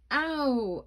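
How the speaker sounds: high, loud yelp of pain; howl of pain. Acted. Boy howling in pain as the mask burns, loud yelp. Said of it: "I howled".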